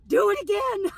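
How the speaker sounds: gleeful shouted plea; yelling through laughter. Retold or imitated. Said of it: "I'm yelling".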